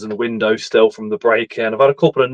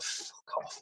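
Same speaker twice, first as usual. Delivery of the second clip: under breath; 24 dB quieter than usual. Retold or imitated. Retold reply to unhelpful police.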